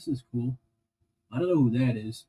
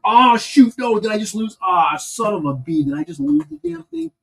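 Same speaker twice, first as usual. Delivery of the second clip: high sudden Oh shoot, then higher worried question; dismayed outburst; 8 semitones higher, 4 dB louder than usual. Real, not acted. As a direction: blurt it out as the piece slips away. Just lost the tiny piece.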